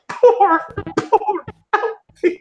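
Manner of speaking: high laughing pity, very slow. Real, not acted. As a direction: laugh through the words, high and drawn out. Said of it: "I choose to point out".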